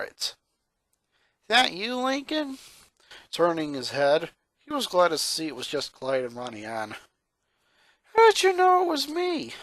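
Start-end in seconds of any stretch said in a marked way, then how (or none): 1.5-2.6 put-on a touch higher, curious boy; gently raised boyish voice; lightly voiced Clyde. A narrator's reading. 8.1-9.5 much higher, startled question; high, surprised young boy. A narrator's reading. Lincoln voiced, surprised.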